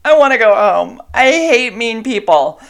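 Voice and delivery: a loud half-joking whine to her partner; whiny outburst. Retold or imitated. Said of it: "called Eric and said".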